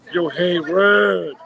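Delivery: loud, drawn-out mock catcall; calling out. Retelling a man hailing his girlfriend across an empty hall, calling out. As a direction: holler it, stretching the last word way out.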